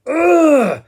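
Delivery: a high, loud groan of pure thrill. Real, not acted. Fan thrilled by the drop into Like a Rolling Stone, ecstatic ugh. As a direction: let out an ecstatic ugh of delight.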